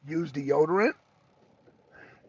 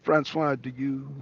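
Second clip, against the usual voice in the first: ordinary talk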